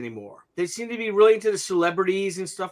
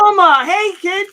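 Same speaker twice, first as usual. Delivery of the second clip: cheerful greeting; 11 semitones higher, 6 dB louder than usual. Real, not acted. Greeting the child.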